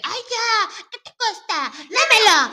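high, loud, laughing demand; hammed-up exasperated nagging; mock pestering. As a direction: imitate someone pressuring you impatiently. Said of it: "te empiezan a presionar".

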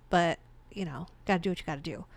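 normal talking voice

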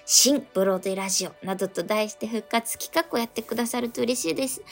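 ordinary delivery

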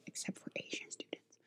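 barely audible whisper; whispered aside. Real, not acted. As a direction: slip in the exception under your breath. Confiding exception muttered as aside.

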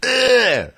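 high mock groan of disgust; disgusted ugh. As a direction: squeal out a grossed-out ugh. Disgusted jocks reacting to kiss.

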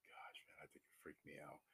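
sighed, voiceless aside; unsettled whisper. Real, not acted. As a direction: whisper it to yourself, rattled. Unsettled recollection, voice drops to whisper.

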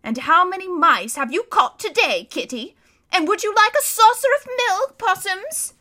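high, loud sing-song taunting; jeering. Acted. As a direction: taunt him like a pet cat. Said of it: "jeering at him".

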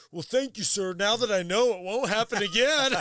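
butter up the boss sarcastically; mock polite sarcasm. Retold sarcastic reply to manager.

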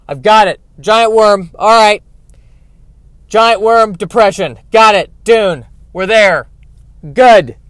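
loud, emphatic bursts, fed up; loud exasperation. Acted. Bored reader loudly giving up on Dune, exasperated. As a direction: done with it, say it loudly in short bursts.

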